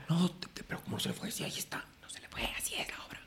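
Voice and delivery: hushed insistent whisper; whispering that the play is meant that way. Retold or imitated. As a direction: keep it under your breath. Whispered exchange continues.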